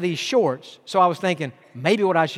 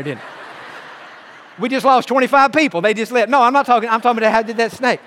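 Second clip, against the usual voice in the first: ordinary talk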